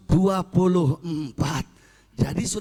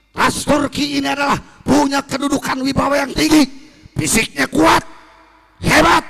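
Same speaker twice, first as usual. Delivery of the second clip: loud, higher rallying speech to a crowd; rousing shouted oration; 5 semitones higher, 7 dB louder than usual. Retold or imitated. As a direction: shout it like a nationalist leader firing up his people. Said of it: "Si Kemal Ataturk ngomong". (Kemal berpidato lantang membakar semangat orang Turki, berteriak keras)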